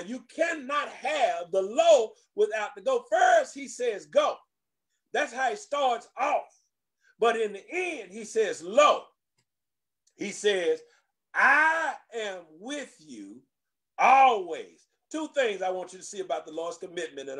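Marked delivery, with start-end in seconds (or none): 0.0-3.0 shouted point. Real, not acted. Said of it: "Get that now". 3.1-16.4 preaching at full force. Acted. Pastor preaching the Lord's promise to the church, full force.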